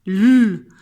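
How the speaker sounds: the bare sound "L", held and loud; L as a sustained sound, not the letter name; isolated phoneme. Shown as a demonstration. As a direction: hum out a long "lll" for L. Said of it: "J'entends le phonème".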